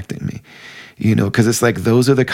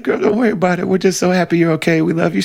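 calling back; 9 semitones higher, faster than usual. Retold or imitated. Speaker calls back reassuringly.

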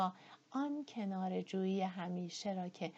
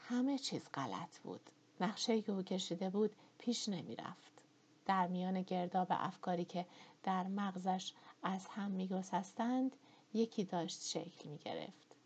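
ordinary delivery

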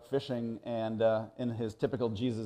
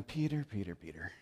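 Peter's name softer and lower each time; soft sad warning; 6 semitones lower, 12 dB quieter than usual. Retold or imitated. Jesus warning Peter of denial, soft and fading. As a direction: call his name gently, fading away.

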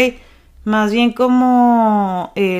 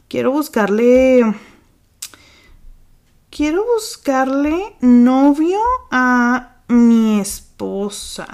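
slow sounding out; 3 semitones higher, much slower than usual. Real, not acted. Slowly sounding out the title.

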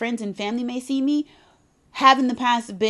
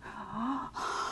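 hushed, imitated scandalized gasp; mock horrified gasp, very soft; shocked crowd gasp; 14 dB quieter than usual. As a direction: suck in air, then a tiny oh.

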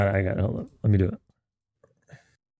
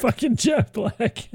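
delighted echo; 13 semitones higher, 3 dB quieter, slower than usual. Real, not acted. Gleeful echo of friend's line.

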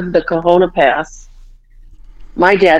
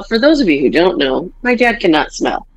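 ordinary delivery

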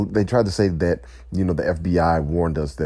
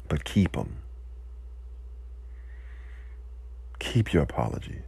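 quieter, pulled-back dismissal; softened retort; 2 semitones lower, 9 dB quieter than usual. Real, not acted. Speaker taking back a harsh line, quiet and subdued. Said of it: "That's a little bit harsh".

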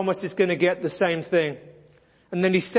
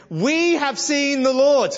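excited claim; 6 semitones higher, 4 dB louder, slower than usual. Acted.

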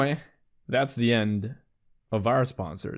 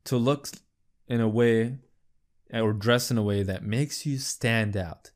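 ordinary talk

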